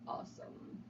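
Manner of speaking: breathy hushed cool-cat word; whispered cool aside. Pretend.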